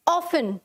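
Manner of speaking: often said as OFF-un, no T sound; often pronounced without its T; often with silent T. Shown as a demonstration. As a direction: say often like "awfun", the T left silent. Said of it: "कि हमें कैसे लिखना है".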